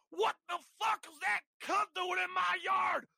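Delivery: high, loud shouting father; enraged father. Acted. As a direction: bellow at an intruder in fury. Angry father kicks open door.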